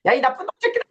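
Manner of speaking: high-pitched imitation of an angry Jesus; angry rebuke. Lecturer acts Jesus cursing the rulers, angry high-pitched rebuke. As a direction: hurl the insult in mock fury. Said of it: "뭐 화내잖아".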